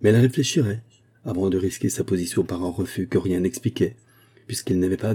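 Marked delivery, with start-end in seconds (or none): none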